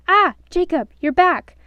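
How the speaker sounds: high, loud, thrilled greeting; eager exclaim. A narrator's reading. The evil boss greeting Geoffrey, loud and thrilled. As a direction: pounce on him with delight. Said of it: "the evil boss exclaimed".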